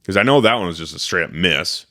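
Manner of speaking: ordinary delivery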